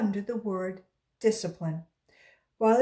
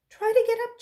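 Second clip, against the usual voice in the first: urging call; 8 dB louder than usual. Acted. Teacher urging Jason up.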